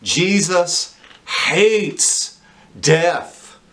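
very slow, word by word, each one weighted; stark declaration. Real, not acted. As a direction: state it starkly, drawing out every word. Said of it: "I can't say it any more starkly than this".

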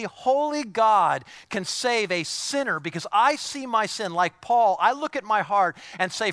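loud, high, driving sermon voice; fired-up pulpit preaching; preaching at full force. Acted. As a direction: preach it out to the whole room, pressing hard.

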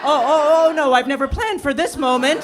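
caught-off-guard fluster; panicked laugh. Retold or imitated. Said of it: "And I was like".

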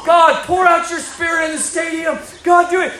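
high, urgent acted prayer shout; re-enacted loud, pleading prayer; fervent revival prayer. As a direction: cry out to God as if praying down revival. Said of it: "I just start praying for revival".

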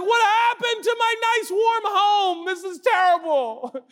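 a newborn missing the womb; complaining baby. Pretend. Preacher voicing a baby missing its warm home, high whiny shout. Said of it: "It's like".

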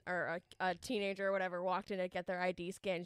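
ordinary talk, nothing marked

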